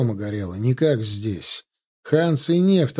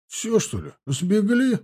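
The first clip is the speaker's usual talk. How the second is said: surprised; 9 semitones higher, slower than usual. A narrator's reading. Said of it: "удивлённо спросил".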